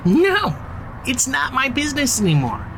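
emphatic refusal. Acted. To herself and the team, dropping the scheming: emphatic high no. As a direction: shut the thought down hard.

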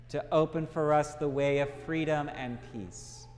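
ordinary delivery

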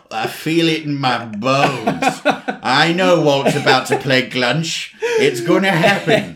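put-on slow creaky old-timer voice; old person voice. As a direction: play a weather-wise old person. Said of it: "like watching an old person when they know it's about to rain".

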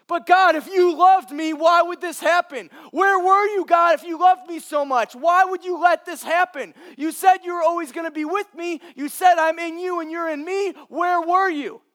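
shouting at God, high and loud, hurt and desperate; anguished cry to God. Acted. A believer in the storm shouting accusingly at God. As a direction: cry out betrayed, voice straining. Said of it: "Have you ever felt that way?".

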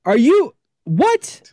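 shocked disbelief. Retold or imitated. Imitating a skeptical viewer caught off guard, stunned high voice. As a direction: imitate someone floored by what they just saw. Retold viewer reacting to his film.

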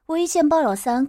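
usual speaking voice

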